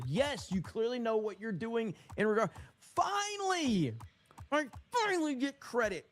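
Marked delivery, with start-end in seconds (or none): 2.8-6.1 high, stretched-out cry of triumph; thrilled, finally. Real, not acted. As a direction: crow it like a long-awaited win. Excited to finally get credit.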